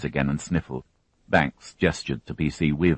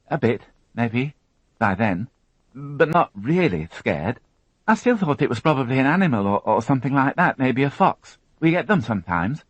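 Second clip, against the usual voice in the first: put-on higher, tense young woman recounting an ordeal; higher, strained witness voice; Alison, shaken young witness; 10 semitones higher, 3 dB louder than usual. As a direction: voice her as a frightened girl holding it together. Alison continues.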